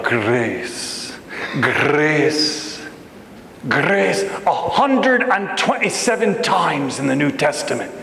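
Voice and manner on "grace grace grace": "grace" drawn out with a Scottish vowel; Scottish or Irish accent. Shown as a demonstration. Preacher relishing the word grace, Scottish or Irish accent. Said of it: "you can't say it except for in Scottish or Irish".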